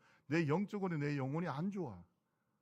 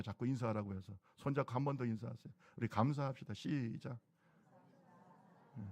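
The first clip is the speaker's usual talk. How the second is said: usual speaking voice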